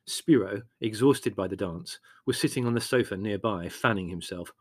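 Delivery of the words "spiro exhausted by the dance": usual speaking voice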